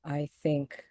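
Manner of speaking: slow, soft, careful advice with long pauses; formal and hushed, pausing between phrases; measured, guarded Viridian. Acted. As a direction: choose each phrase carefully, quietly. Plays Viridian advising Mister Han.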